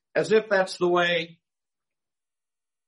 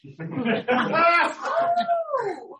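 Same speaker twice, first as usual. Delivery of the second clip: big laugh. Real, not acted. Congregation laughs at pirate joke.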